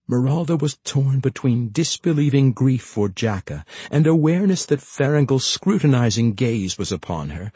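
usual speaking voice

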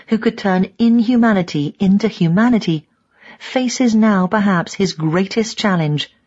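ordinary delivery

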